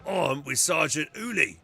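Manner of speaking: loud, high, protesting Ogryn. Acted. Ogryn insists his sergeant will return, loud Ogryn voice. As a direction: insist loudly like a loyal, simple soldier. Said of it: "I'm already exhausted from doing the Ogren voice".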